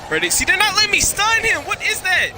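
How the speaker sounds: high, loud raging yell, slowing on the last line; angry shout. Real, not acted. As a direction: rage at the game. Raging at the game.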